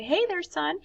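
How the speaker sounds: a hint of a father calling hello; lightly voiced dad greeting his son; dad's greeting. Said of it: "the dad said".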